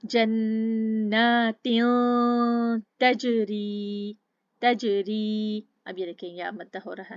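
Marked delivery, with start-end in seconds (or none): 0.1-5.6 very slow, louder chanted verse; tajweed recitation. Shown as a demonstration. Teacher recites the next verse for students, slow chant. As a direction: draw the verse out slowly. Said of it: "अच्छे से grip करके चलते हैं".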